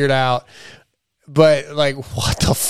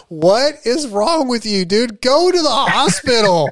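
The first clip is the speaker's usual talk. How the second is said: higher, slow disbelieving scold; exasperated scolding; 7 semitones higher than usual. Real, not acted. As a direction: plead with him to see sense. Mock scolding a stubborn rider.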